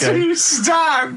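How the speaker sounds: very high, slow, stretched-out mock pronunciation of Tattoo Stan; mock grand name. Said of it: "That's a province in Russia".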